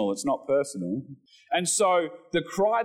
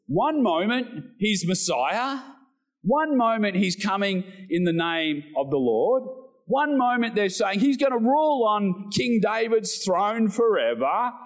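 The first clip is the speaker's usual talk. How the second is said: higher, rising each time on one; building preacher's cry; 5 semitones higher than usual. Acted. Preacher rousing the congregation about the crowd's shift. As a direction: stack the phrases and climb.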